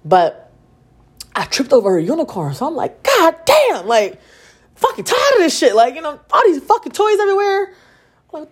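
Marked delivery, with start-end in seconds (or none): 3.0-3.9 high, loud exasperated curse; exasperated outburst. Retold or imitated. Mom cursing after tripping over a unicorn, exasperated. 4.8-7.7 high-pitched fed-up venting; exasperated rant. Retold or imitated. Mom fed up with toys everywhere, exasperated.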